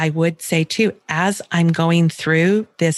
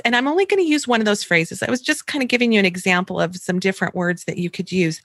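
ordinary talk, nothing marked

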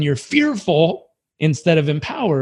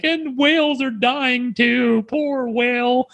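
high and slow, hammed-up sorrow; playfully overdone grief for a whale; mock weepy pity; 8 semitones higher, slower than usual. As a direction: whimper it out in exaggerated pity. Mocking someone sad about everything.

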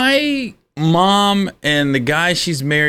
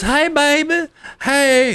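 put-on high, coaxing girlfriend voice; sweet girlfriend; 12 semitones higher, slower than usual. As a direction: sweet-talk the angry boyfriend. Girlfriend voiced in retold story.

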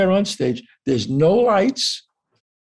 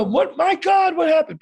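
high-pitched, startled calling out; alarmed neighbour; 12 semitones higher than usual. Retold or imitated. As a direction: imitate a startled onlooker at a window.

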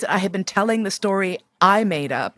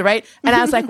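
ordinary talk

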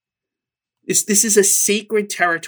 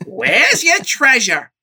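high, loud, slow scolding toy mom; Mrs. Potato Head voice; 5 semitones higher, 5 dB louder than usual. Retold or imitated. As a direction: nag like a big cartoon toy mother. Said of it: "I made it Missus Potato Head's voice".

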